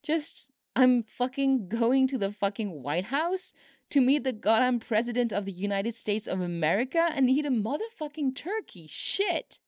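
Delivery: overwhelmed and incredulous, pitched well up. A narrator's reading.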